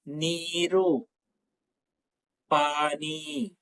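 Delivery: each word slow and clear, with pauses; slow teaching voice. Shown as a demonstration. Teacher saying each Hindi word slowly for students to repeat. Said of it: "నేను స్లోగా చెప్తున్నాను".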